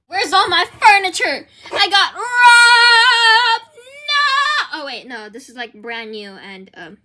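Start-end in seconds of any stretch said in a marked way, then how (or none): 0.1-4.7 shocked wail. Acted. Finds house robbed, wails.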